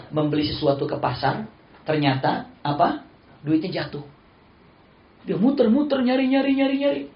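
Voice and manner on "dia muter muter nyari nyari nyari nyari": frantic searching. Retold or imitated. Slave searching for money.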